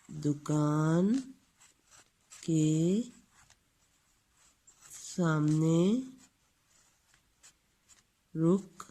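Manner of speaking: each word spaced out slowly; long pauses between words like dictation. Shown as a demonstration. Reads sentence word by word.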